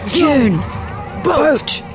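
firm mommy voice on June, boat, now; parent to toddler. Retold or imitated. [name] imitating Moira bossing June like a toddler. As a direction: order it like talking to a small child. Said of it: "I recognize that tone of voice".

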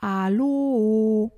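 loud, drawn-out echo; echoing call. Acted. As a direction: mimic an echo bouncing back. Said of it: "schallt das Echo zurück".

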